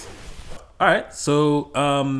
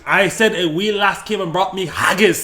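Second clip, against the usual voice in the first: put-on Scottish accent; 3 semitones higher, 6 dB louder than usual. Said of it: "flame us in the comments for that accent".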